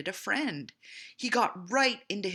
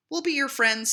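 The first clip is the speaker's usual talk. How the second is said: higher and louder, bright offer; eager friends; 9 semitones higher, 8 dB louder than usual. A narrator's reading. Little Beaver promises the turtle friendship, eager and loud. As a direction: cheer the turtle on.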